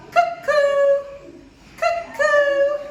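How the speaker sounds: very high, loud sung cuckoo; cuckoo clock call. Acted. Cuckoo clock calls out at night, very high and loud.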